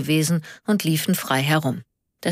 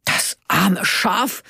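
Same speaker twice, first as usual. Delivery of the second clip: slow, higher indignant question; incredulous echo; 4 semitones higher, much slower than usual. A narrator's reading. Karl repeats Onno's words, indignant and incredulous. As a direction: throw it back slowly, rising in outrage.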